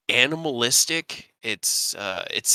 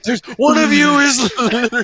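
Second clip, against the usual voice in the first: laughing incredulous quote; 13 semitones higher, 10 dB louder than usual. Retold or imitated. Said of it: "I'm like".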